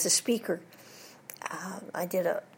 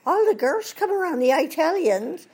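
excited Irish drinker, pitched up; Irish pub voice; 10 semitones higher, 7 dB louder than usual. Retold or imitated. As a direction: wail it in a lilting Irish accent. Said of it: "And the other one says".

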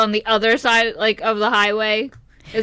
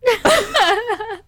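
bright burst of laughter; high giggle; 11 semitones higher than usual. Real, not acted. Laughs at friend eating mic.

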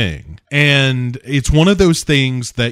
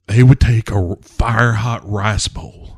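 ordinary delivery

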